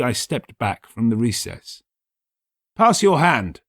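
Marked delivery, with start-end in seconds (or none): 2.7-3.6 raised, louder narrator quote; Montresor's quoted speech. A narrator's reading. Montresor to Fortunato, lightly voiced raised plea. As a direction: speak to the trapped man, a bit lifted. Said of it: "I said".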